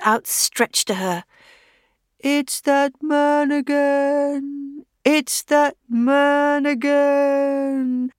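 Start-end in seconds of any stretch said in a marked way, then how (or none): 2.2-8.1 very slow, higher moaning cry; slow mournful wail. Acted. Scarecrow stumbling toward the widow, wailing slowly. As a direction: wail it out, drawn and pleading.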